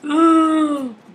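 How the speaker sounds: sharp, high, loud gasp; startled loud gasp. Real, not acted. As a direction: gasp in shock. Sudden discovery, loud gasp.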